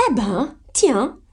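slow, drawn-out, high retort; defiant cry. A narrator's reading. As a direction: exclaim slowly with pitch leaping. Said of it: "s'écria Joe". (s'écrier, en traînant sur les mots)

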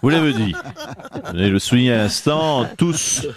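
low, put-on politician voice, hammed-up heartbreak; low mock-wounded lament; mock jilted politician. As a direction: play the betrayed boss in a low caricature voice.

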